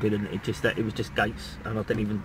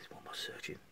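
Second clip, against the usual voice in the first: barely voiced whisper; whispered to himself; 22 dB quieter than usual. Real, not acted. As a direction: breathe the question under your breath.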